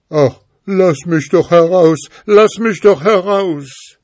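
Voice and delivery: high, loud wail of a captive; pitiful pleading. A narrator's reading. Tricked spirit begs the schoolboy, pitiful high cry. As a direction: beg plaintively to be let out. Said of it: "der Geist rief ganz kläglich".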